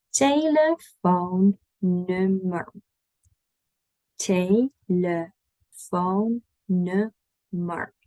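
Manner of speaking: "Telefoonnummer" very slow, syllable by syllable; slow syllable drill. Shown as a demonstration. Teacher models pronunciation for repeating, slow and broken up. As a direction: stretch out each syllable for learners.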